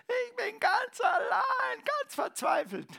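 high, loud crying shout of despair; imitated desperate cry. Retelling a woman at worship night, imitating her desperate crying shout. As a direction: cry it out as a lonely woman breaking down. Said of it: "jemand hat hier geschrien".

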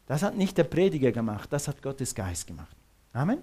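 ordinary talk

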